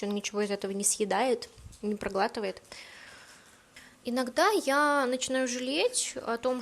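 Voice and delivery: ordinary talk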